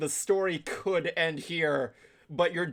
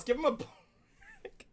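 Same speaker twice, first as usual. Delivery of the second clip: mock pleading; 11 semitones higher, 6 dB quieter, much slower than usual.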